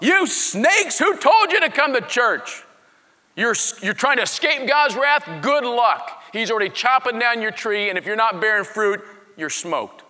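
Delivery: high, forceful desert preacher; John the Baptist. Acted. Preacher in the desert warns his listeners, loud scolding. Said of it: "That's the way he talked".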